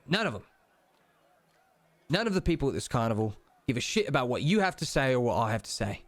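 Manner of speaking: deliberate Cockney with weighty pauses; Cockney deity counselling, phrase by phrase; Cockney British accent. Acted. Deity advises the player on power in a Cockney accent. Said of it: "kind of Cockney British accent".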